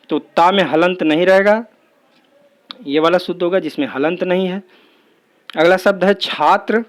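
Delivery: normal talking voice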